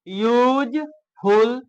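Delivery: "यूजफुल" slowly, with a gap between use and ful; split into syllables. Shown as a demonstration. Teacher showing two syllables, "यूजफुल" slowly split in two. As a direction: draw out both halves of "यूजफुल". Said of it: "दो syllable हो गया".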